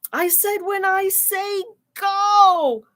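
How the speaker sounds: high, slow exasperated scolding; exasperated correction. Acted. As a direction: stop her, annoyed she jumped early. Jack scolding Annie in story.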